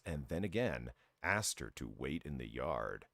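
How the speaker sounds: ordinary talk, nothing marked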